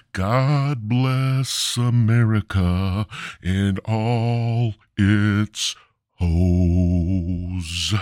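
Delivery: very slow, drawn-out preacher blessing; mock solemn blessing. Comedian blessing America like a hammed-up preacher, very slow. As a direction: stretch it out like a grand benediction.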